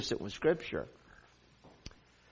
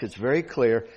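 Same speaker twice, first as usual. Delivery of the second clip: ordinary delivery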